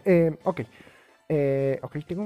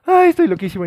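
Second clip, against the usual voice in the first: grito divertido; 15 dB louder, faster than usual. Real, not acted.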